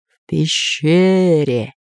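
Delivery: slow, drawn-out spirit answer; ghost voice. Acted. Spirit reveals where treasure lies, slow ghostly voice. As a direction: intone it eerily, stretching the words.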